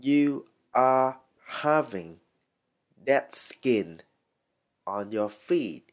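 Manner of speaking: "You are having Dead skin" slowly, in separated chunks; slow teaching model of "Dead skin on your feet"; word by word. Shown as a demonstration. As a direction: pause before "Dead skin" and after it. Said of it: "các bạn có thể nói là".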